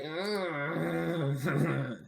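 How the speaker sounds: low, soft whiny mock groan; reluctant demon. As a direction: groan it like a sulking freeloader. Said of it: "He's gonna be like".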